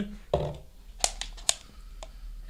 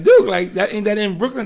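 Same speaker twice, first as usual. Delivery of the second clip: louder, higher, half-laughing challenge; incredulous, amused protest; mock disbelief; 8 semitones higher, 8 dB louder than usual. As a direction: act out an incredulous comeback to a stubborn local. Acted exasperated reply to Coney Islanders.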